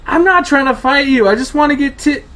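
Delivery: high, loud desperate plea; frantic pleading. Acted. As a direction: beg him to back off. Pleading with attacker, hands up.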